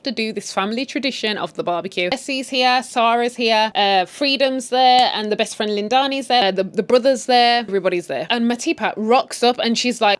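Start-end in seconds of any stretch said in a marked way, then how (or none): none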